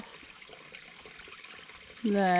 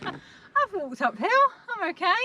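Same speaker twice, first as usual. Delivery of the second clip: mocking imitation; 9 semitones higher than usual. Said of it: "she says".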